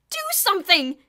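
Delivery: high, loud screeched demand. A narrator's reading. Diane to Bruno, screeching. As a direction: screech it at him. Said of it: "Diane screeched".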